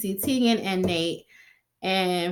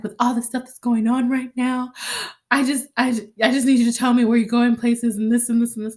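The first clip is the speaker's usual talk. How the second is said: a hint of an anxious, higher boyfriend; lightly voiced worried Oliver, a bit fast; 4 semitones higher, 3 dB louder than usual. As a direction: rattle it off like a fretful partner.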